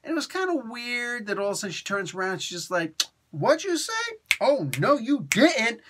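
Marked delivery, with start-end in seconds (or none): 3.3-5.8 higher, louder sassy comeback; mock outraged sass; sassy confrontation. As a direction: go right at him, indignant and raised. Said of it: "she just goes right at him".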